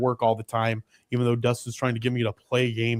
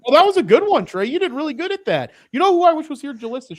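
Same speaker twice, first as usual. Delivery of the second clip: excited; 11 semitones higher, 6 dB louder, faster than usual. Real, not acted. Teasing praise of Trey's attempt.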